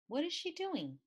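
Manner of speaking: high, puzzled wonder; wondering what sister is up to; curious surprise. A narrator's reading. Peeking at sister, curious and puzzled.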